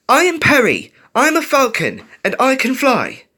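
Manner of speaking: high, loud declaration in short bursts; rallying self-belief shout; shouted affirmation. A narrator's reading. Falcon chanting a shouted self-affirmation.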